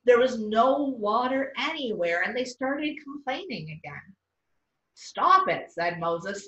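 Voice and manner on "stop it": firm rebuke. A narrator's reading. Moses scolds complaining people.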